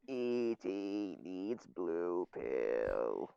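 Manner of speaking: low, very slow synthesized voice; flat computer voice box, slowly; robot voice. Retold or imitated. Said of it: "Stephen Hawking now".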